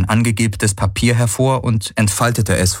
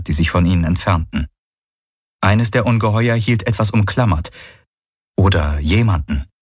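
ordinary delivery

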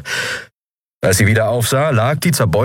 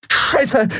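high gasped curse. A narrator's reading. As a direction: Endriel curses while diving for her weapon, gasping high. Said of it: "keuchte sie".

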